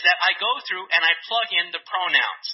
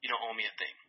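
soft, low and quick aside; compassionate; 9 semitones lower, 13 dB quieter, faster than usual. Acted. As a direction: drop low and quiet, granting mercy. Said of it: "the king has compassion and says".

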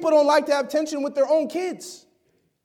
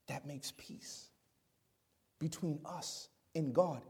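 hushed whisper; 9 semitones lower, 17 dB quieter than usual. Acted. Awed sermon climax about the Lamb.

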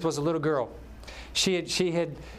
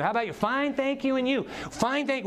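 higher, sing-song church chit-chat; mock chirpy small talk; 9 semitones higher than usual. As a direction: parody the automatic pleasantries. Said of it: "we got that down".

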